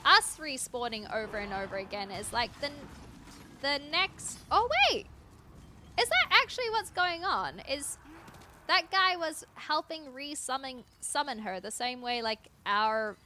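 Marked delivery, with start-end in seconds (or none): 4.5-7.6 sudden realization. Real, not acted.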